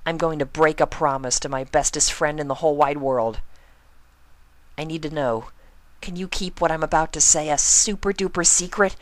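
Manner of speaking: high, quick, serious pony voice; earnest Pinkie. Acted. Pinkie confiding in Spike, high and earnest. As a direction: confide a big secret, high and fast.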